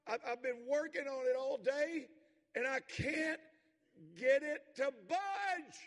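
high, loud frustrated shout, slow at the end; a kid yelling his frustration at his dad. Retold or imitated. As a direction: son yelling at his father about the stump. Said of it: "He's yelling at his dad".

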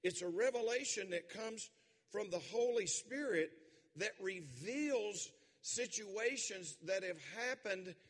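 loud, rising preacher's cry; preaching at full force. Acted. Preacher building fervour before the congregation. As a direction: drive it home to the church.

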